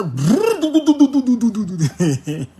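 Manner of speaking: rolled brrr into rapid du-du-du, sliding down; vocal V8 engine noise, drawn out; imitated engine rumble. Showing off how the V8 roars, imitated engine rumble. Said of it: "ça fait".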